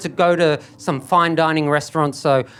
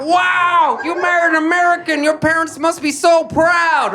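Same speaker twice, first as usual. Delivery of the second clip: much higher and louder, gushing; gushing American; 10 semitones higher, 3 dB louder than usual. Retold or imitated. Americans congratulate him on marriage, gushing much higher and louder. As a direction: gush over him like an excited fan.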